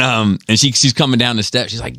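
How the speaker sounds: ordinary talk, nothing marked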